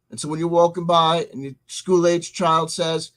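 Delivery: ordinary delivery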